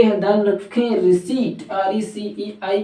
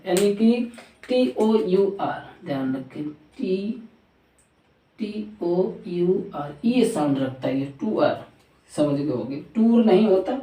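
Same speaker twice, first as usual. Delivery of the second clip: ordinary talk, nothing marked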